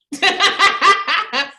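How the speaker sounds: high, loud laugh at herself; amused high laugh; laughing at her own demo; burst of laughter. Real, not acted.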